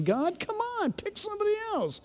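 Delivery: soft, high-pitched mock whine; pleading protest. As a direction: squirm out of it with a hushed, high plea. Voices reluctant believer pleading with God.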